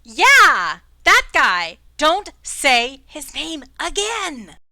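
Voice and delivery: loud exasperated scolding; loud, slow final warning; scolding shout. Acted. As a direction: tell them off for saying it. NPC scolds about the name.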